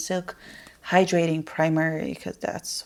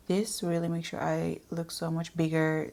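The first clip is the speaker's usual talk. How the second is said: ordinary talk, nothing marked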